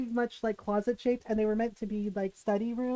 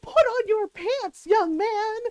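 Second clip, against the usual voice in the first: scandalized scolding; 8 semitones higher, 5 dB louder, slower than usual. Retold or imitated. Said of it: "It's like".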